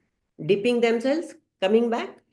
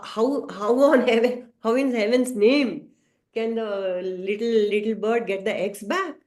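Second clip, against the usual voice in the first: ordinary delivery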